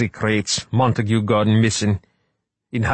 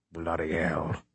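muttered; 9 dB quieter, slower than usual. Acted. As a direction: mutter it under the breath. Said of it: "muttered Cuthbert".

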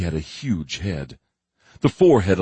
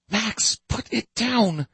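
hissed through teeth; slower than usual. Acted. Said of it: "Robin hissed through clenched teeth".

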